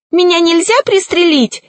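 high-pitched sorcerer in alarm; frightened. A narrator's reading. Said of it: "испугался колдун".

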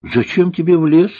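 high-pitched puzzled question; surprised. A narrator's reading. Cook questions girl about forest, surprised. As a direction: lift the pitch right up in surprise. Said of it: "удивилась повариха".

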